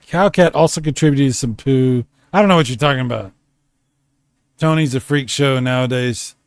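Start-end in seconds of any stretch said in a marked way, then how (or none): 2.3-3.3 rattled off quickly; fast mock denial. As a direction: toss it away quickly.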